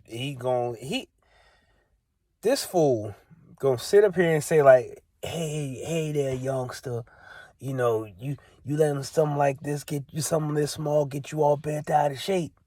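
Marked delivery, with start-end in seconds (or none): 5.2-12.5 mocking condescending stranger. Mocking the stranger who lectured him at the gate, soft patronizing voice. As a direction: talk down to him, quiet and smug.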